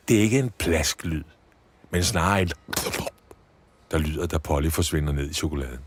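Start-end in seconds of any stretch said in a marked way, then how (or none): none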